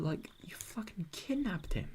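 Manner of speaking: whispered aside, slow; stunned whisper. Real, not acted. Gamer whispering to viewers about the kidnapper. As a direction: hush it like a shocked aside to the audience.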